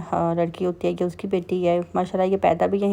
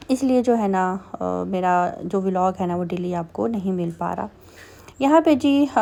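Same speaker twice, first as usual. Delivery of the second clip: normal talking voice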